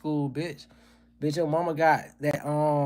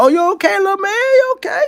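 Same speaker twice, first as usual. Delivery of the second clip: very high, loud, sugary voice; hammed-up sweetness over a tripped kid; mock sweet concern; 11 dB louder than usual. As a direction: coo it like a fake caring grown-up. Fake-caring after tripping a bully.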